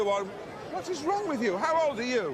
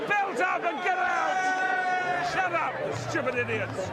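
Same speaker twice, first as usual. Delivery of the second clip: angry shout; 9 semitones higher than usual. Real, not acted. Commentator scolding rowdy crowd.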